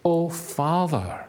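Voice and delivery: a hint of a child calling for its father; believer's cry. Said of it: "our instinct is to say".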